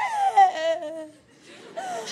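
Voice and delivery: loud mock wail; drawn-out hammed-up sob; imitated crying. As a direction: imitate a big tough guy bawling. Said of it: "Biglang umiyak".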